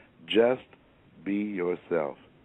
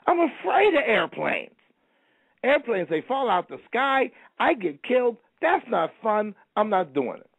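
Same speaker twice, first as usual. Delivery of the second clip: high, fast-rising frightened voice, much higher; a scared man pleading, pitched way up; panicky high man; 11 semitones higher than usual. Retold or imitated.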